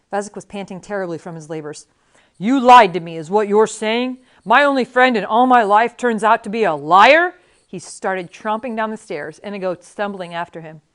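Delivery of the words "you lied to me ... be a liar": loud, outraged accusation; indignant accusation. A narrator's reading. Fezzik confronts his lying friend Inigo, loudly indignant.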